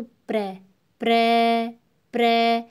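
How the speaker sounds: "प्र" held long and loud, on a level tone; उच्चारण demonstration. Shown as a demonstration. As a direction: stretch "प्र" so the final र is heard. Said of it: "प्रश्न हम जब बोलते हैं तो". ("प्र" का लंबा, ज़ोरदार उच्चारण)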